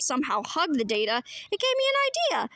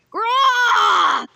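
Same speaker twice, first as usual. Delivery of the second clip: monster roar; 8 semitones higher, 9 dB louder than usual. Acted. Robot ghost grabbing for the vest, loud roar. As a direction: roar like a raging machine.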